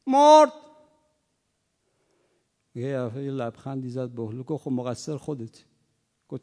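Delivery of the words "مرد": loud, high cry of the mourner; mourner's wailing outburst; mock grief-stricken shout. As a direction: belt out the friend's death.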